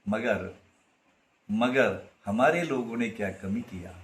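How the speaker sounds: hushed Hindi poetry line; soft recited verse. Acted. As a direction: drop the voice for the sting. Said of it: "Khaleel Gibran ... ಬರ್ದಾನ".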